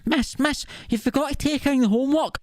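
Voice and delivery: mock schoolkid piping up, high-pitched; eager pupil impression. Pupil calls out to the teacher, mock eager. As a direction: imitate a keen pupil calling the teacher in a high voice.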